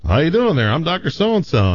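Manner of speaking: Southern doctor. Acted. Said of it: "the doctor says".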